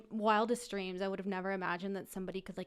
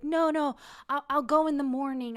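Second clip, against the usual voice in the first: loud, higher-pitched imitation of pleading; mock protest; 6 semitones higher, 8 dB louder than usual. Date begging to stay till morning, loud protesting. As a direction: stall insistently, voice raised.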